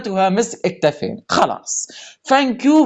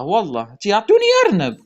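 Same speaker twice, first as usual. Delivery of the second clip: usual speaking voice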